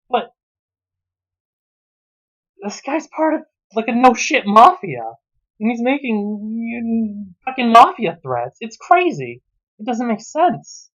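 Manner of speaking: high-pitched disbelief; incredulous. Real, not acted. Reviewer baffled that the dad makes mafia threats. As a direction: sound baffled by the nonsense.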